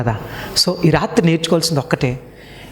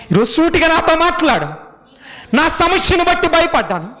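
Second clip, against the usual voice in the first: preaching at full force; 13 semitones higher than usual. Acted. Pastor leads the crowd in a pleading prayer, preaching at full force. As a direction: cry out to God from the pulpit.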